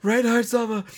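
high-pitched frantic calls, held back in volume; mock yelling. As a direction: imitate someone yelling a name over and over. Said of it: "he's just yelling".

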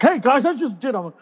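high-pitched TV pitchman voice; hammed-up peppy salesman greeting; Vince. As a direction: imitate a chirpy infomercial host. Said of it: "Vince, the guy was like".

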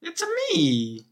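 very high cartoon Italian plumber; Mario voice. Joking round of video game voices, high Mario voice. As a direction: squeak it like Mario.

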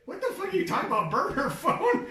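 high, loud, laughing what-what-what; laughing disbelief. Real, not acted. Friend stunned she carried a burner phone, laughing shock.

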